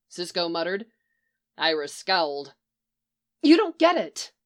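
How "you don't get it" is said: high loud annoyed snap; sharp annoyed retort, louder and higher; snapped. Acted. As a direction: bite back, irritated. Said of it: "Iris snapped".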